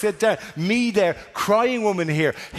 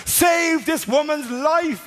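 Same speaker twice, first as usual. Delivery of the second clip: high, slow, hammed-up cry for help; dramatic plea; 12 semitones higher, much slower than usual. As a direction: cry out like a film hero. Boy pleads dramatically with doctor.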